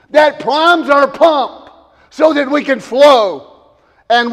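loud, slow, fervent sermon; preacher driving it home to the congregation; preaching at full force. Acted. Pastor urging the church, loud slow preaching.

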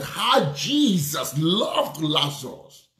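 very slow, each word drawn out; preacher's drawn-out exclamation; marvelling scripture quote. Retold or imitated. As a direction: linger on every word as a preacher quoting.

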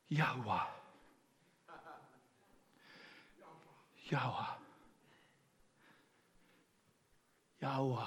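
"Yahweh" as a near-silent exhale, almost no voice; breathed name. Shown as a demonstration. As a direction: breathe out "Yahweh" like a soft sigh. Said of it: "here's how you would say it".

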